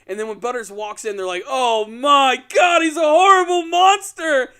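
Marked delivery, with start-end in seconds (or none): 1.5-4.5 hammed-up horrified scream, high and loud; hysterical freak-out. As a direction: wail like a terrified parent in mock horror. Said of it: "freaking out".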